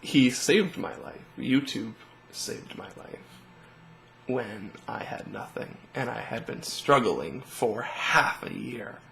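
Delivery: fighting real tears as he speaks. Real, not acted. Telling viewers how YouTube saved him, choked up. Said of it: "And I can be emotional".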